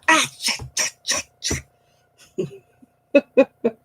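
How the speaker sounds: high yelp then whispered curses; pained swearing. Retold or imitated. Getting up off the chair, swearing in pain. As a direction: curse under your breath getting up. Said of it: "I'm like".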